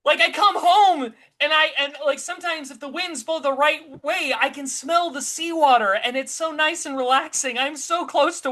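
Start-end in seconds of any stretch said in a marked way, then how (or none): 0.0-2.2 high, loud, agitated insistence; real alarm. Real, not acted. Co-host frets about living on the coast, high agitated alarm. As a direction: protest in a raised, worked-up voice.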